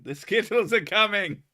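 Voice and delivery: high loud yell. Pretend. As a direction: shout it like a mall alarm. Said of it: "run through the mall yelling".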